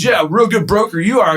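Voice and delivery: mocking, high-pitched taunt; retold sarcastic jab. Angry tenant belittling the broker, sarcastic.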